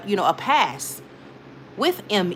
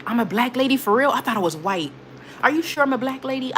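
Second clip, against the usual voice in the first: feigned surprise, questions pitched up; put-on puzzlement with higher-pitched questions; mock disbelief; 4 semitones higher than usual. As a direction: play innocently baffled, tongue in cheek. Feigns surprise at troll's label.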